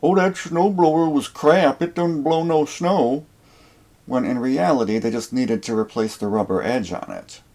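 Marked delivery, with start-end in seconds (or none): 0.0-3.3 higher whiny voice imitating a grumbler; mock a lazy customer complaining; mocking complainer. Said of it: "start telling everybody".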